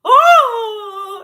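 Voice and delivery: loud, high crying "oh"; imitated wail. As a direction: bawl it out as in a crying fit. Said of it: "crying and".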